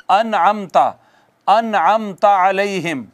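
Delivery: अनअमता recited slowly with a clear nasal नून; slow recitation. Shown as a demonstration. Teacher reciting अनअमता slowly for students. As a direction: spell out अनअमता slowly, syllable by syllable. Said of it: "ये सबक हमने पढ़ा था अनअमता".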